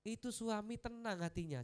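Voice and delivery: normal talking voice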